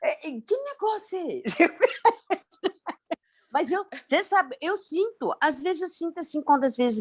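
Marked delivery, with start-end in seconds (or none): 0.0-1.6 higher-pitched mock bafflement, breaking into laughter; amused bewilderment. Student tells teacher how baffled she was, amused bewilderment. 1.6-2.3 high-pitched laugh; amused laugh. Real, not acted. Student laughs at her own confusion, amused laugh.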